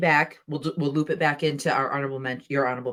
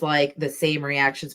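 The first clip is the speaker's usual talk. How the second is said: ordinary talk, nothing marked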